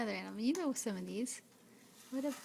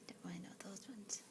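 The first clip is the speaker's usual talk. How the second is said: whispering; 4 semitones lower, 14 dB quieter, faster than usual. Real, not acted. Whispered aside.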